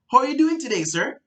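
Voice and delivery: the too-excited salesman, way up high; overexcited salesman. Shown as a demonstration. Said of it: "you don't want to be".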